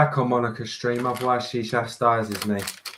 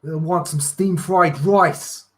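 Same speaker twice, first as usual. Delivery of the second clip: New York accent; 2 semitones higher than usual. Shown as a demonstration. Showing off impressions for Monica, New York accent. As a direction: lay on New York vowels, breaking into laughter. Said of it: "on my New York".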